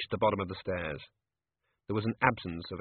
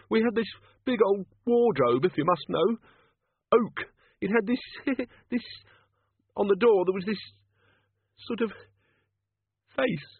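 raised, halting scared memory; nervous high voice; 11 semitones higher, 4 dB louder than usual. Acted. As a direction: tell it haltingly, uneasy. Chickenwire recounts fearfully.

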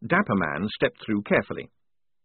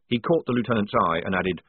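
ordinary delivery

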